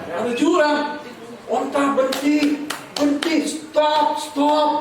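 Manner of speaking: high, slow, half-sung cries of stop; panic at the cliff. Retold or imitated. Preacher acts Udin seeing the ravine, high mock panic. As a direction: stretch the panic out, pitched high.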